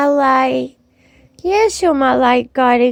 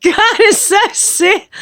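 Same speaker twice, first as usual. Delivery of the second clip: ordinary talk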